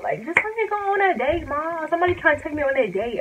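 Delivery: put-on high-pitched whiny teenage plea; pleading with mom. Begging strict mom for permission.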